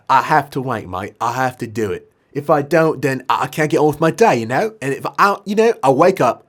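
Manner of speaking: animated British lad pleading; British accent. Acted. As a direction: explain earnestly in a put-on British accent. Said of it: "he was like".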